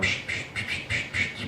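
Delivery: hissed psh of a spray can; spray hiss sound. Retold or imitated. Telling listeners about his gear, a mimicked spray hiss. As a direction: mimic spraying scent cover. Spraying scent cover on himself.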